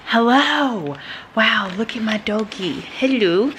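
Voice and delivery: doting pet-greeting voice; talking to a dog. Real, not acted. Greeting her dog on camera.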